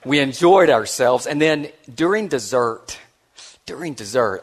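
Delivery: ordinary talk, nothing marked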